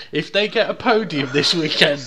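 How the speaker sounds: laughing while speaking; words breaking into laughter. Real, not acted. Laughs at his own joke.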